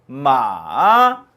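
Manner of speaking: 마 dipping low then rising; 마 falling to a low dip, then climbing again; third tone. Shown as a demonstration. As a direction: sink 마 down and bring it back up. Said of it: "삼성".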